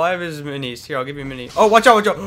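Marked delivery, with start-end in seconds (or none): 1.6-2.2 loud, urgent warning; urgent shout. Real, not acted. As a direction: yell a quick warning. Enemy attacking teammate.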